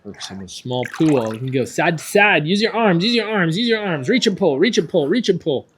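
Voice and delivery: loud, high swim cues; echoey pool coach shouting. Real, not acted. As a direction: call the strokes across the water. Coach calling strokes to child.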